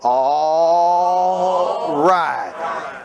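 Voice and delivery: Mississippi drawl, word by word for repeating; Southern Mississippi accent. Shown as a demonstration. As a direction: lead a chant in a Southern accent, stretching "all right". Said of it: "in your best Southern Mississippi accent".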